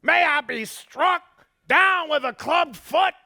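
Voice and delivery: high, loud, slow desperate oath; desperate swearing. Acted. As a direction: swear it loud, pleading not to be known. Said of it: "he began to swear and affirm oaths".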